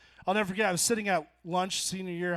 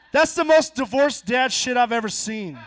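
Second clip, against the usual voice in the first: ordinary delivery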